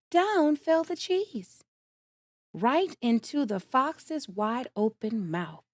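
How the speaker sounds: ordinary delivery, nothing marked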